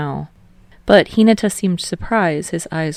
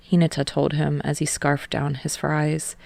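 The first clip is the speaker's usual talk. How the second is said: ordinary delivery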